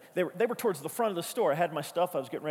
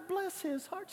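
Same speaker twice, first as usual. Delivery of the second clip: high, soft Southern drawl, bless his heart; Southern accent; 12 semitones higher, 6 dB quieter than usual. Retold or imitated. Pastor mimicking Southern accent at Walmart. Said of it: "I'd gone into this voice".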